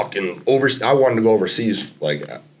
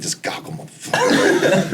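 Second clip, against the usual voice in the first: laughing mock outrage; 10 semitones higher, slower than usual. Opponents mad at goggled Ian.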